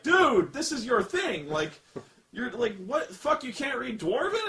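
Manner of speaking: high, loud comic outburst of disbelief; mock incredulous. Reviewer scolding dwarves who cannot read Dwarven, mock incredulous. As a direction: exclaim it as if calling out a clueless friend.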